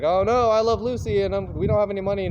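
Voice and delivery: high, loud, fast mock panic. Movie heroine who cannot pay, in mock panic. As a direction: play a broke sitcom heroine panicking. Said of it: "protagonists are like".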